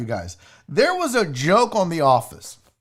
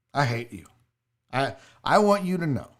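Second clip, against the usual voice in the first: low, deadpan resentment; quietly fed up, pretend bitterness; mock hatred; 8 semitones lower, 3 dB quieter than usual. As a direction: drop low and grumble with playful loathing. Fed up with chat's puns.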